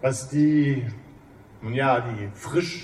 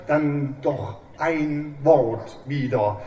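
ordinary talk

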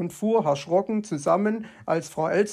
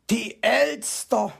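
higher, slow indignant fox cry; indignant exclamation; 6 semitones higher, 3 dB louder, slower than usual. A narrator's reading. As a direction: call out, outraged. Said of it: "rief er entrüstet aus". (entrüsteter, langsamer Ausruf)

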